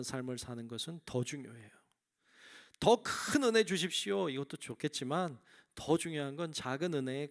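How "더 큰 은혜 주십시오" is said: a pleading prayer pitched high and loud; eager prayer. Retold or imitated. A preacher mimics a believer begging for grace, raised and pleading. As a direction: beg God for more grace, out loud.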